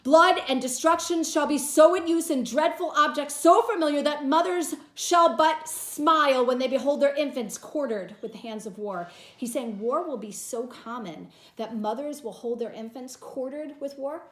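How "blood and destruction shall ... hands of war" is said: loud, high, fierce declamation; dramatic reading. Acted. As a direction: paint the horror of war. Teacher reads Antony's speech dramatically.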